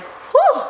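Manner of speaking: high sigh of relief; relieved whew. Acted. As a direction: blow out with relief. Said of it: "I'm relieved".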